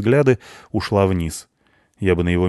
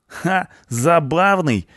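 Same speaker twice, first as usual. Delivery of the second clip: high, cocky echo of ЗАБАВНЫЙ; scoffing punk; 11 semitones higher, much slower than usual. A narrator's reading. Punk echoing the blonde's compliment, cocky scoff. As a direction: throw ЗАБАВНЫЙ back with a scoff, pitched high.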